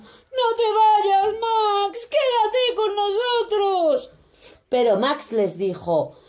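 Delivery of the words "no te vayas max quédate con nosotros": mucho más agudo, lento y lloroso; ruégale que no se vaya; súplica llorosa. A narrator's reading. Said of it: "Los monstruos le decían". (monstruos suplicando, agudos y llorosos)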